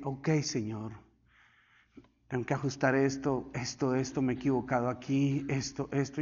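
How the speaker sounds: low, soft, humble murmur to God; subdued prayer. Retold or imitated. Retells humble night prayer to God. (oración íntima, en voz baja y sumisa)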